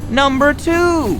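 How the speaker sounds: high, slow, drawn-out cry of a grandmother; excited call. Acted. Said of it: "Graham shouted".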